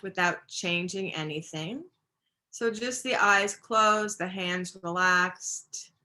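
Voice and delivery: unhurried yoga-teacher meditation voice, phrase by phrase; even, measured breathing-practice guidance with long pauses; guided meditation. Real, not acted. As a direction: guide them gently through the breath, pausing between phrases.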